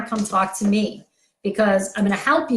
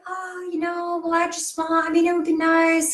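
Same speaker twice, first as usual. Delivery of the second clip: softy voice; 6 semitones higher than usual. Retold or imitated. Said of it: "softy energy".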